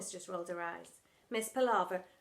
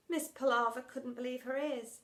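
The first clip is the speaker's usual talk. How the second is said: ordinary delivery, nothing marked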